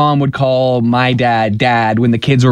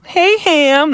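a higher chirpy Ham greeting, lightly voiced; imitating coworkers. Co-host mimics coworkers greeting each other, higher. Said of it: "They call each other Ham".

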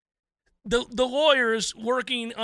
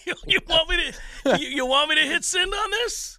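laughing, louder and higher; laughing repeat; 7 semitones higher, 3 dB louder than usual. Retold or imitated. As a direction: rerun the line through laughter. Laughing repeat of the lawyer line.

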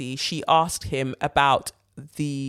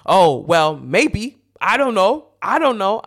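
quoting [name] dodging; 3 semitones higher, 6 dB louder, slower than usual. Retold or imitated.

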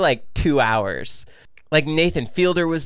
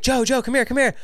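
high, urgent retold call; mock urgent summons; imitated beckoning; 10 semitones higher than usual. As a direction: imitate friends calling you over. Friends urgently calling him over.

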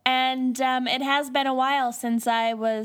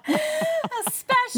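squeaky, much higher, slow; high-pitched; slower than usual. Pretend. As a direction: squeak it out slowly. Mock high exclamation.

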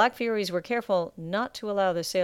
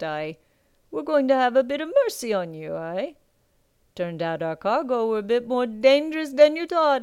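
gruff pirate captain; 3 semitones higher, 4 dB louder than usual. Acted. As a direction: lord it over the captured pirates. Captain taunts kneeling pirates.

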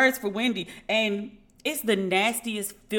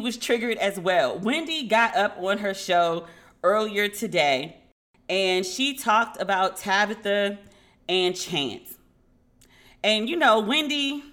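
ordinary delivery

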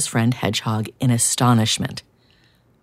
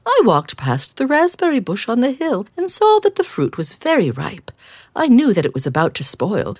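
kind fox; 6 semitones higher than usual. Acted. Missus Fox speaks.

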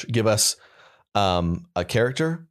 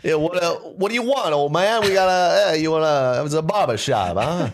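high, loud hammed-up Boston barber; comic barber voice, pitched high and loud; 8 semitones higher, 3 dB louder than usual. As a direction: bark it like a gruff Boston barber. Improvised barber in Boston scene.

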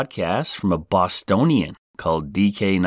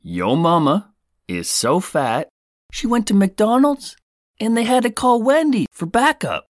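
hammed-up joke delivery; 13 semitones higher than usual. Podcaster performs his kids' favorite yo mama joke, hammed-up and high.

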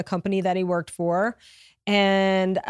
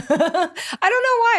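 high, loud laughing through the words; nervous laugh breaking up the words; 14 semitones higher, 4 dB louder, much faster than usual. Real, not acted. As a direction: laugh away the tears. Nervous laugh over teary admission.

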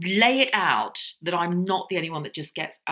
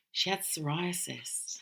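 whispering; 12 dB quieter, slower than usual. Retold or imitated. Bride's whispered reveal.

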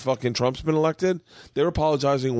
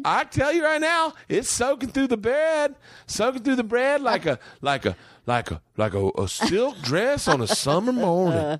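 impression of wife's family; 13 semitones higher than usual. Husband imitating wife's family on stage, high folksy impression. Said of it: "This is my impression of your family".

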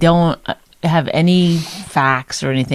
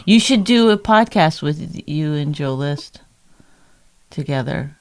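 ordinary talk, nothing marked

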